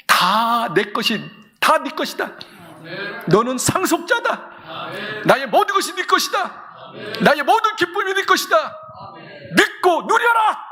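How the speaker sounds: rising from calm to a soaring shout; building preaching cry. Acted. Preaching climax as God's voice. (점점 고조되어 외치듯 선포)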